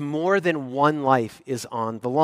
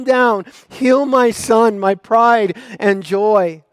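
slow, higher, louder begging; pleading father; 8 semitones higher, 9 dB louder, slower than usual. Acted. Father at Jesus' feet begging him, slow pleading. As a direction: beg for your son's life.

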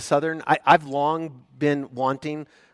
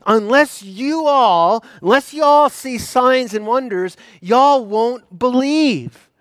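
higher, louder, slow, stressing all; addressing the crowd; 10 semitones higher, 8 dB louder, slower than usual. Acted. Jesus looking out over the Galileans, loud and slow. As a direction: call it out to the whole crowd, leaning on all. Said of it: "he looks out at all of them and says".

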